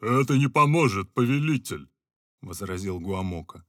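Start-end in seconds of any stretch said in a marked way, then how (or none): 0.0-1.9 louder calm objection; lightly voiced advisor; a hint of the owl. Owl's voice. (лёгкий голос филина)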